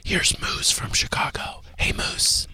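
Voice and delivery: hushed whispering host voice. Acted. Host whispering to his co-host on the Whisper Show. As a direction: keep the whole show in a whisper.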